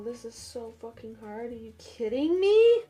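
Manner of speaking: ordinary talk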